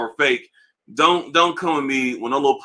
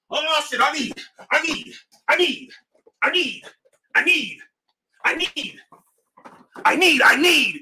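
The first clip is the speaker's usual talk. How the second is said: much higher, needy mock pleading, I need repeated; mock desperate need; 11 semitones higher than usual. YouTuber begging on camera, high mock neediness. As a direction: whine it like you can't live without them. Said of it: "when they're doing all the most".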